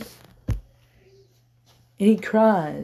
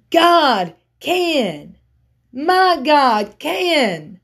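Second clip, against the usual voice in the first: high-pitched, fervent sermon cadence; full-force preaching; 8 semitones higher, 4 dB louder than usual. Acted. Preacher telling church God can do it, fervent full force. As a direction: drive each phrase high, then let it fall.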